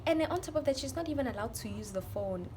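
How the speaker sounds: usual speaking voice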